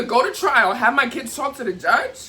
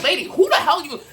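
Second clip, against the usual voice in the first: indignant retort; 5 semitones higher than usual. Retold or imitated. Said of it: "I'm like".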